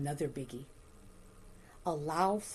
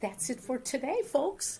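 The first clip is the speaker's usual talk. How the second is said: cheerful sign-off; 9 semitones higher, 3 dB louder than usual. Real, not acted.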